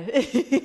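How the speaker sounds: short awkward laugh; embarrassed laugh. Real, not acted. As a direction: laugh off the mistake. Laughs after skipping a speaker.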